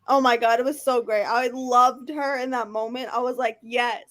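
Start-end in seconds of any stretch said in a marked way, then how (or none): none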